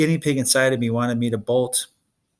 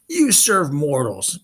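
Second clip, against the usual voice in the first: slow, raised monster shout; shouting Cyclops; 3 semitones higher, slower than usual. Acted. Polyphemus shouts at Tyson, slow and raised. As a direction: spit the accusation slowly. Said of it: "Polyphemus shouted".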